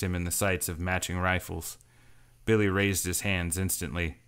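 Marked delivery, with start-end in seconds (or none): none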